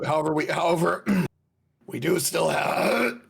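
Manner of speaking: put-on old man voice. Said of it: "He's going into his old man voice".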